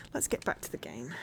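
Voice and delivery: whispered aside. Real, not acted. Aside before resuming game.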